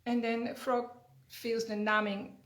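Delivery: usual speaking voice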